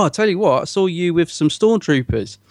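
normal talking voice

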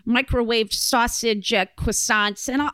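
loud, brash New York order; New York accent. Pretend. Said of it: "and be like".

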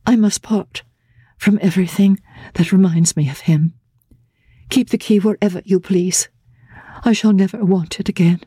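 a bride bracing for hard duty; Laura resigned. Acted. Laura gives up the key.

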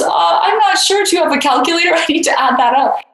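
dumbfounded. Retold or imitated. Lynn answers the donor's huge offer, high flustered disbelief. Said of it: "Lynn was so dumbfounded".

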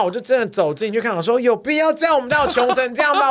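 acting stunned at home; incredulous disbelief. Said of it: "我想说".

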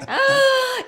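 loud high gasp for air; big gasp. Retold or imitated. Coming up for air, loud gasp. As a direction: suck in air loudly. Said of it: "Agarrar aire lo más que podía".

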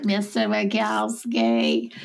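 slow, drawn-out character imitation; mocking monster impression, dragged out; Monsters, Inc. monster. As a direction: stretch the name out like a cartoon nag. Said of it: "one of the monsters from Monsters".

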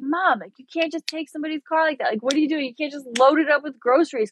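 high, fast, exasperated telling-off; mock scolding. Imagined scolding of her mom.

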